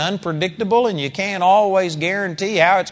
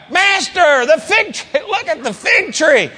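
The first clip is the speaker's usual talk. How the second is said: much higher, amazed and urgent; a stunned disciple pointing out the tree; overwhelmed Peter; 10 semitones higher, 4 dB louder than usual. Shown as a demonstration. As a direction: awestruck cry of Peter. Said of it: "I believe it was more like".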